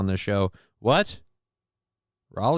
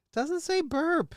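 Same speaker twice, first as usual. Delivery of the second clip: mocking high voice; 3 dB quieter, slower than usual. Mocking a chatter's claim.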